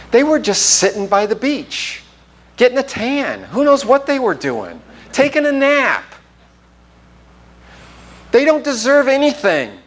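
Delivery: high-pitched, acted scorn; mock indignation. Fighters complaining about those by the beach, mock indignation. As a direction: play a resentful complainer, pitched well up. Voicing resentful fighters against the idlers.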